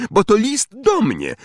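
high-pitched indignant protest; shout. A narrator's reading. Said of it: "krzyknął Harry". (wysoki okrzyk oburzenia)

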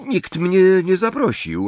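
high, glum complaint; a touch of sulky disappointment; gloomy. A narrator's reading. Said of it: "odpowiedziała Parvati ponuro".

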